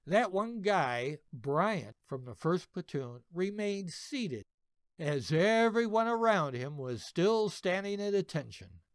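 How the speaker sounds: usual speaking voice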